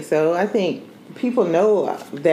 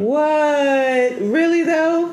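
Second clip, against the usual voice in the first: mock surprise; 10 semitones higher, slower than usual. Mock outrage at Chase Young shoutout.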